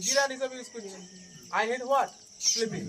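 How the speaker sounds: usual speaking voice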